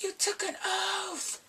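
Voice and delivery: high and slow, scolding; indignant quote. Retold or imitated. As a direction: remind the doctor of her duty.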